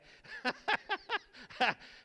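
loud high burst of laughter. Real, not acted. Pastor laughing at his own joke about the accent.

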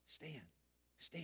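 hushed, soft calling of a name in the dark; mock whisper. Minister whispering to Stan in the dark room, hushed. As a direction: whisper his name like a secret. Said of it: "while we're whispering".

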